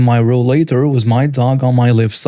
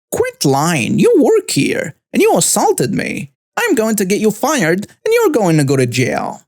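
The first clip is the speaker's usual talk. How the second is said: much higher, accusing Karen voice; Karen ranting; 8 semitones higher than usual. A narrator's reading. Karen threatens to get the veteran fired, much higher ranting voice. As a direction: jab each accusation up high.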